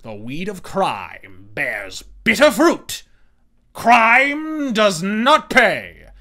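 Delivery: slow menacing radio narrator; Shadow radio voice. Retold or imitated. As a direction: intone it like the old radio Shadow. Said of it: "The Shadow knows".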